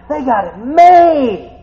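high, loud, slow proclamation; slow ringing declaration. Real, not acted. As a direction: stretch it out, proclaim. Preacher repeating consolation.

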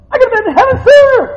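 quick, high, loud rush to sooner. Real, not acted. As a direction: rattle it off. Repeated faster.

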